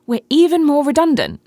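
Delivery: loud, high outburst. Acted. Ranting rises to peak.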